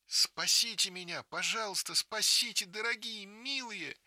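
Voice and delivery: high, loud pleading scream; little girl screaming for rescue; terrified scream. Acted. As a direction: scream for help in terror. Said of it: "кричит".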